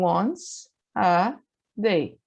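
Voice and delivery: once a day spelled out very slowly, word by word; careful, drawn-out model phrase; slow teaching demo. Shown as a demonstration. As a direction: stretch each word so students can copy. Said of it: "Eu digo, então".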